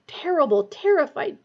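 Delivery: ordinary delivery, nothing marked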